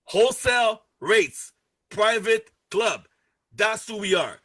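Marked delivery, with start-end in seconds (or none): 0.0-1.5 slow, weighted delivery of Wholesale rates; slow emphasis. Shown as a demonstration. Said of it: "Take notes". 1.9-3.1 very slow, deliberate private club; slow emphasis. Shown as a demonstration. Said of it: "Take notes".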